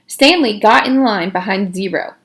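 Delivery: plain reading voice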